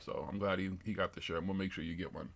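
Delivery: normal talking voice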